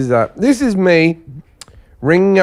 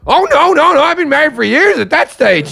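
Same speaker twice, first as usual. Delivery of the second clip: imitating Brian; 10 semitones higher than usual. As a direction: blurt the truth, high and eager. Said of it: "He goes".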